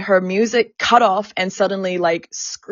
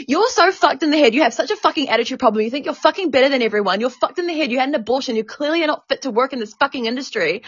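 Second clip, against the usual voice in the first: higher, fast retold tirade; going off at someone; 5 semitones higher, faster than usual. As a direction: imitate a manager's relentless angry rant. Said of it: "She starts going off at me".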